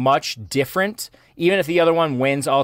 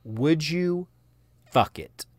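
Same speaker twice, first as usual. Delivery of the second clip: ordinary delivery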